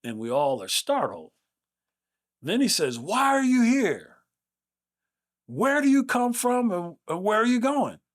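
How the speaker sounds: loud, high-pitched booming challenge. Retold or imitated. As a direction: call out each question like a professor startling a room. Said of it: "with a loud voice".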